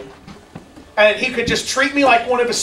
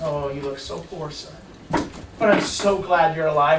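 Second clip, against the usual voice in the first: much lower and softer, tender relief; tender father; 7 semitones lower, 6 dB quieter than usual. Acted. A father welcoming his lost son home, low and soft. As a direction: hush down to a gentle fatherly welcome.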